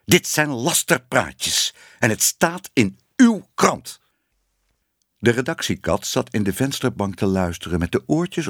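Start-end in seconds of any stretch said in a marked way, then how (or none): 0.0-4.0 slow, high-pitched angry accusation; indignant outburst. A narrator's reading. Ellemeet blaming the editor for slander, indignant. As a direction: hammer out each accusing word slowly. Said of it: "riep Ellemeet".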